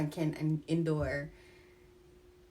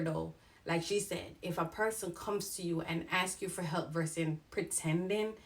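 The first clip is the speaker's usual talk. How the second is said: ordinary delivery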